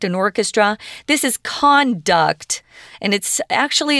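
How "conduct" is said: slow, careful "conduct", CON-duct; stress on first syllable. Shown as a demonstration. Teacher showing the noun's pronunciation, first-syllable stress on "conduct". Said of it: "pronunciation's different".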